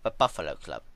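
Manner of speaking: plain reading voice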